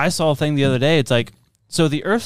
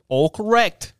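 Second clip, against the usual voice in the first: thick Boston "All correct", aah for all, r dropped; Boston accent; 7 semitones higher, slower than usual. Retold or imitated. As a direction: say it like a real Bostonian. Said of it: "how the Boston people said it".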